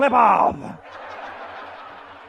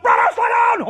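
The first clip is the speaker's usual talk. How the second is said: high, loud yell to his brother; screamed plea; 9 semitones higher, 4 dB louder than usual. Retold or imitated. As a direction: scream it up over the wind. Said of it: "I screamed up".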